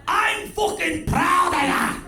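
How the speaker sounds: high, slow Scouse dad bellow; a dad roaring his pride at his son, high and slow; proud dad shout. Retold or imitated. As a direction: yell it like a proud Liverpool father. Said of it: "that same paternal pull".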